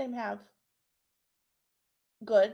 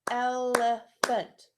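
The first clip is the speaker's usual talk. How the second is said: "elephant" drawn out slowly and louder, each syllable apart; slow syllable clapping; 6 dB louder, much slower than usual. Shown as a demonstration. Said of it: "How many claps?".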